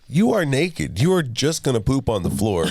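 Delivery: mock-scold a naked toddler; exasperated dad. Dad warns naked daughter she'll poop on the floor, exasperated. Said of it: "I was like".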